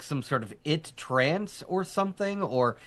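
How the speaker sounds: usual speaking voice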